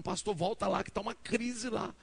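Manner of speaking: retold urgent summons, rising to the end; urgent calling out. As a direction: call him back in alarm, pitch climbing. Someone runs after him calling urgently.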